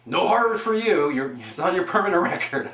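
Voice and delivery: a hint of a pompous administrator, laughing at the end; mock stern authority. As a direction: ham up the strict principal threatening a student, then crack up. Mock scolding of a student.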